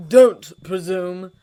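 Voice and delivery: loud slow biting warning; vicious snap. A narrator's reading. Holmes warning Snape off, vicious slow snap. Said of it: "Holmes snapped viciously".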